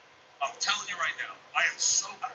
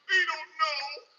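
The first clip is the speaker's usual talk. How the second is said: high, wailing mock little-boy voice; imitated crying child; 11 semitones higher, 4 dB louder, much slower than usual. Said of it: "freaking out, crying".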